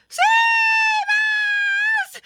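long, loud, very high shriek; panicked cry. A narrator's reading. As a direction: stretch it into a squealed plea. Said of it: "cried another".

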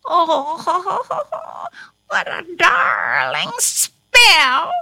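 witch's cackling delight, drawn out; laugh, then crow with triumph. A narrator's reading. Witch pleased with her mischief, crowing gleefully. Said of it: "she crowed".

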